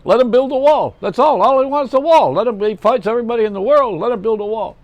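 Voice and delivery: high, loud, insistent comic rant; Jackie Mason. Retold or imitated. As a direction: bellow the joke like an outraged stand-up. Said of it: "He says the most outrageous thing".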